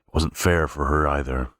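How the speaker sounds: plain reading voice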